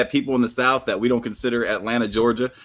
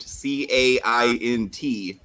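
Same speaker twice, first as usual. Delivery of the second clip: each letter slow and separate; deliberate slow spelling; spelled out slowly; much slower than usual. Shown as a demonstration. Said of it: "they spell it caint".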